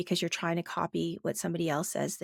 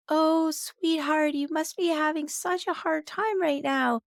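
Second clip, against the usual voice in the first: put-on sweet older woman; 9 semitones higher than usual. Football coach copying someone else to empathize with his kids, sweet older woman voice. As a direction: coo it like a sweet older lady. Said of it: "older woman with a very sweet voice".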